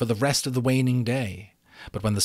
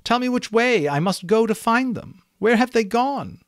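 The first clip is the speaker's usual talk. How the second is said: much higher, louder pleading unicorn; 12 semitones higher, 7 dB louder than usual. A narrator's reading. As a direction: beg him for an answer. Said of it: "she pleaded".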